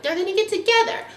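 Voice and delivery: high, gushing prediction; cutesy hopeful tone. Retold or imitated. Viewers gush about the couple, high and cutesy. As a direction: gush like a shipper.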